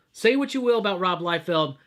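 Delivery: ordinary talk, nothing marked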